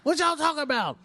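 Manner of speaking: high shouted street caller; loud high caller voice. Retold or imitated. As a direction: yell it like a clueless caller. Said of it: "you can be like Riggs and be like".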